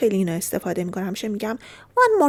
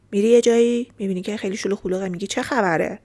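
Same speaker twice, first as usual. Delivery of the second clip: ordinary talk, nothing marked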